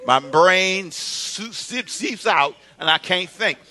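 slow, stretched words; comic drawn-out excuse. Acted. Dater explains why he can't visit, drawn-out comic excuse. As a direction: drag out the seeping for effect.

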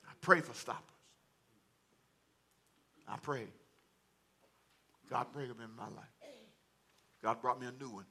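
low, soft prayerful confession; subdued, intimate plea from the pulpit; hushed preaching. Real, not acted. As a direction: let it sink quietly, with long pauses.

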